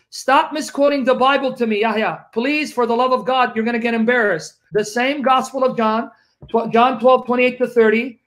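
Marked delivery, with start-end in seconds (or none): none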